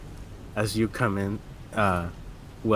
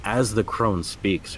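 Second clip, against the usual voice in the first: ordinary talk, nothing marked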